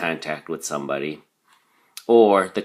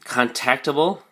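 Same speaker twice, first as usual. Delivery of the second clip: contactable as con-TAC-table, rising at the end; stress on second syllable; 6 semitones higher than usual. Shown as a demonstration. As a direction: say contactable higher, stressing TAC, with an upward lilt. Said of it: "emphasis is on the second syllable".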